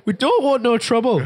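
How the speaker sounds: high, put-on British plea; mock British accent. Host joins in mocking the appeal, high British accent. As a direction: parody a pleading Brit, pitched well up.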